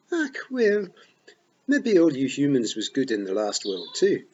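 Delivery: high, magnanimous Scots pixie voice; grandly allowing humans were good too; generous Rob. A narrator's reading. Rob generously reassures Tiffany, kindly. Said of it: "said Rob Anybody generously".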